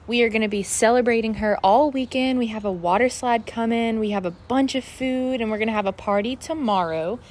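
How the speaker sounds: ordinary talk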